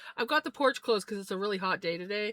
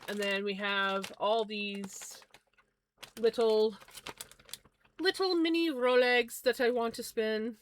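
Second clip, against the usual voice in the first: ordinary talk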